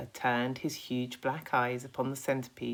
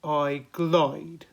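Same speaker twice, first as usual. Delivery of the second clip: primly; 3 semitones higher, 7 dB louder than usual. Acted. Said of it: "said the Earthworm primly".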